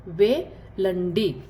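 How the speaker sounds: वेलंडी stretched out very slowly; very slow repeat. Shown as a demonstration. Teacher repeats Telugu for go very slowly. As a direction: draw वेलंडी out syllable by syllable. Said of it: "जाने को कैसे बोलते हैं".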